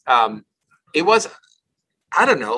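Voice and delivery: usual speaking voice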